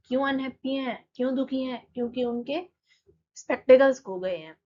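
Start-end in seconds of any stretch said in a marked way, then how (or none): none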